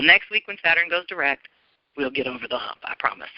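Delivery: ordinary talk, nothing marked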